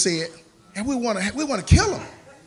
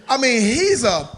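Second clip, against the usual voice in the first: ordinary talk, nothing marked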